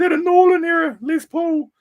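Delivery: high, loud excited customer; eager fanboy voice. Retold or imitated. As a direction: squeal it like a thrilled fan. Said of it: "I'd always be saying to him".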